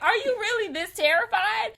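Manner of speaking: high, loud incredulous question; mock amused disbelief. Friends laughing at her terror outside the theater, mock incredulous. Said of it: "just died laughing".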